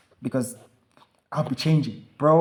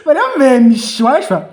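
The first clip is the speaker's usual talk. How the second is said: higher, louder teasing; hammed-up scolding; loud mock outrage; 8 semitones higher, 6 dB louder than usual. Real, not acted. Mocking the student barber to his co-host, loud.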